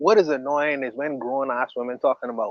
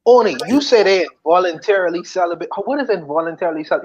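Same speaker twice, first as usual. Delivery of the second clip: normal talking voice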